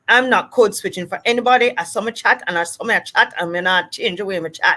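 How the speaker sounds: quick, defiant Jamaican patois. Acted. Person refusing to code switch, quick Jamaican patois. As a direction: dig in, in rapid Jamaican patois. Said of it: "person who decides".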